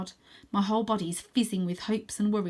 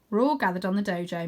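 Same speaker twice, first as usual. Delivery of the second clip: usual speaking voice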